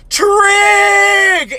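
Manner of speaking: loud high yell of one word; Kirk-style scream. Retold or imitated. Little brother screaming about math like Kirk, loud yell. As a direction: bellow it like Kirk yelling Khan. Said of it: "he screamed it like Kirk in Wrath of Khan".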